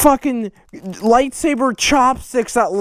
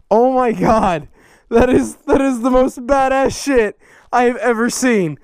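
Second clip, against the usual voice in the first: usual speaking voice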